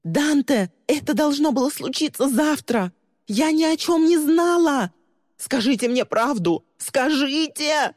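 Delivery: very high frantic screaming; desperate screaming. Acted. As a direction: scream over the chaos. Lee screaming amid arrest.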